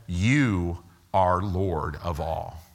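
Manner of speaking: low, slow and solemn, every word weighted; slow reverent emphasis. Shown as a demonstration. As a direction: lay each word down slowly like a confession. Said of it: "In other words".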